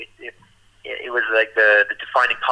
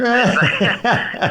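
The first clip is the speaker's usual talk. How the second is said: laughing; 10 semitones higher, much slower than usual. Real, not acted. Laughing while retelling.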